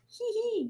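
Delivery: high little "hee hee"; light, high acted titter; read-aloud giggle. A narrator's reading. Robot giggles at Ribbit's remark, tittering. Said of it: "giggled Robot".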